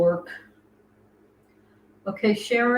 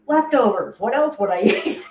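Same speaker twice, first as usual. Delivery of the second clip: mock indignant reply; 3 semitones higher, 6 dB louder than usual. Acts out her reply to friends.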